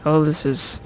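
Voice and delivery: imitating a serious sergeant's message; serious recruiter voice. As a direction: put on a stiff military tone. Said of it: "it's this really serious".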